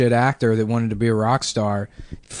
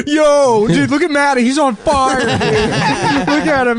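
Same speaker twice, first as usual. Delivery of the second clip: high-pitched excited yell, breaking into laughs; mock teenage shrieking at a stunt gone wrong; loud, way-up-high hollering, gleefully panicked; hysterical kid friends; 12 semitones higher, 3 dB louder than usual. Acts friends of burning kid yelling.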